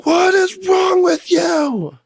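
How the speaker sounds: high, drawn-out scream of outrage; full scream. Retold or imitated. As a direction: scream it slowly, pitch way up, stretching each word. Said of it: "And I scream".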